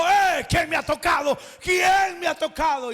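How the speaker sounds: Jesus stopping to call out loudly; urgent calling out. Acted. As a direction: demand to know who touched you. Jesus asks who touched him.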